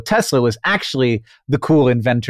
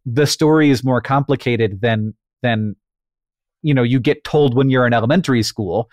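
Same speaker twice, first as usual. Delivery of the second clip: ordinary talk, nothing marked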